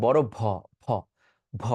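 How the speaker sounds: ভ with a strong puff of air; breathy bh demo. Shown as a demonstration. Teacher showing the aspirated ভ students wrongly use. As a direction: blow air out on each ভ. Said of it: "একটা বাতাস চলে আসছে".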